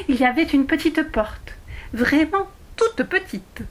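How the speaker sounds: plain reading voice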